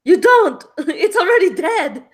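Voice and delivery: loud exasperated laughing cry; laughing shout. Acted. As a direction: yell it through laughter.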